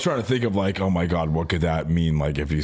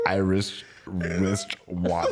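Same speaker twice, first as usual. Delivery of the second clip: laughing attempt; 3 dB quieter, much slower than usual. Real, not acted. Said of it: "One more".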